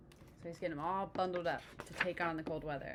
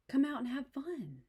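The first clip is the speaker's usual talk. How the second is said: coaxing; 7 semitones higher, 3 dB louder than usual. Acted. Frog coaxing Toad.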